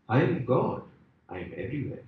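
lightly voiced calm deity; voice of God. God introduces himself to the dangling man, lightly voiced, calm.

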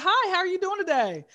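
high, prim professional customer-service voice. Retold or imitated. Greeting a celebrity at her kiosk in a put-on proper voice. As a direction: sound extra proper for the celebrity.

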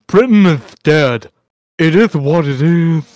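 loud, put-on declaration; Chad. Host quoting Chad's reply to Hemi, in a loud mock voice. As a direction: imitate him with a mock, emphatic proclamation. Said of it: "Chad goes".

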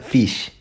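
"fish" with a tight "ee", like feesh; Australian vowel. Shown as a demonstration. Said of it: "Australians say".

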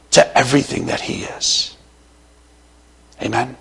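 breathy whisper to end; whispered amen; whispered close. Real, not acted. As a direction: drop to a whisper on the last words.

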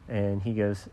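ordinary delivery, nothing marked

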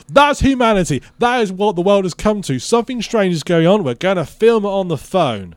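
loud, high-pitched exclamation; mock indignation. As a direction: declare it loudly, like a grand verdict on mankind. Mock exclaimed thought in story.

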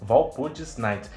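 ordinary talk

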